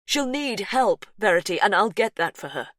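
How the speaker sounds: insist, unyielding; firm insistence. Acted. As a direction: brother insisting the child needs help. Brother insists.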